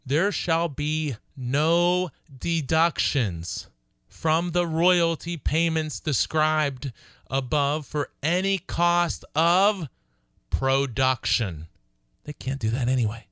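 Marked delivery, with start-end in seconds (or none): 0.0-11.7 slow, higher, word-by-word reading of the clause; verbatim reading. Shown as a demonstration. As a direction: read the lease clause out deliberately, word by word. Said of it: "I'm gonna read this verbatim".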